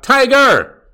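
high, loud holler of the name; fans' yell. Retold or imitated. Fans calling to Tiger Woods, loud yell. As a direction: yell it like a fan in the gallery. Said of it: "And the fans yell out".

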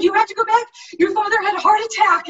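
high, loud urgent news; urgent fan. Retold or imitated. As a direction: blurt it out, frantic. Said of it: "She's like".

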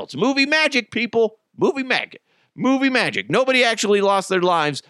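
high insistent repetition; sing-song it at them, high. Real, not acted. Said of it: "defending himself".

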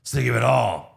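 deep mock-villain speech, rising at the end; Jigsaw. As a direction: imitate a grave killer, then push the last words. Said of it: "where Jigsaw's like".

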